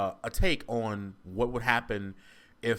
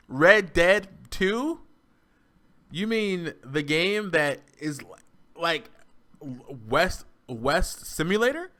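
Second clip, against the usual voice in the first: slow, raised-pitch disbelief; incredulous questioning; 5 semitones higher, 4 dB louder, slower than usual. Real, not acted. Fan defending Red Dead Two to critic, incredulous questioning. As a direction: draw out each phrase, stunned that anyone said it.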